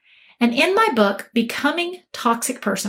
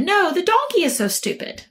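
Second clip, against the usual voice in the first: higher-pitched, squabbling comeback; retorting tiger; 6 semitones higher than usual. Acted. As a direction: throw the insult straight back, lightly acted. Tiger retorts before the king lion.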